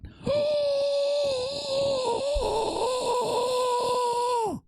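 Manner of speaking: drawn-out high gasp turning into a mock cry; hammed-up shocked wail; high, long theatrical gasp-cry.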